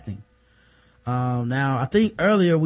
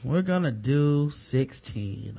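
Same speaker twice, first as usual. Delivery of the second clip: ordinary delivery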